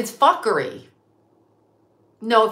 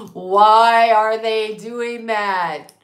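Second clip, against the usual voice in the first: very slow, louder, stretched-out question; drawn-out demand; 5 dB louder, much slower than usual. Real, not acted. Questioning the media's motives, slow exasperated demand. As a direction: stretch every word in exasperation.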